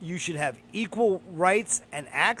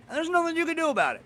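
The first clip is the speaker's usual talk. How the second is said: fast and higher, fired off in outrage; fast, high-pitched indignant burst; exasperated outburst; 10 semitones higher, much faster than usual. Real, not acted. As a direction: rattle it off, pitch climbing with indignation. Outraged point in rant.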